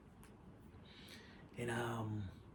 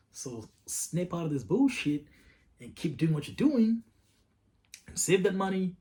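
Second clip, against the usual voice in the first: rising, louder pep talk to himself, slowing at the end; self pep talk; 3 semitones higher, 7 dB louder than usual. Retold or imitated. Talking himself into keeping going, building up. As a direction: psych yourself up, building to a push.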